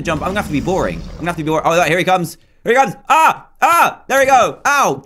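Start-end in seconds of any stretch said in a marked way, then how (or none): 3.1-4.0 high loud yelp as the dragon hits; startled yelp. Real, not acted. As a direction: cry out in surprise. Dragon attacks, screams.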